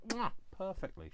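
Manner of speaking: high smacking kiss sound; chef's kiss. Acted. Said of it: "Chef's kiss perfect".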